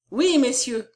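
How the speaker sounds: a touch of a shout; crying out. A narrator's reading. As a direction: call it out to the company. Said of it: "cria-t-il".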